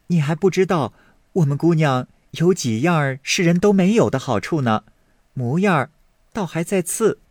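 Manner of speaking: a hint of a sweet young maid. Maid Yinger listing knot patterns to Baoyu, lightly girlish. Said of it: "婴儿笑道".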